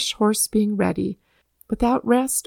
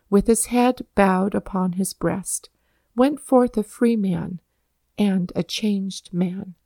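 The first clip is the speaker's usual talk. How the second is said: ordinary delivery, nothing marked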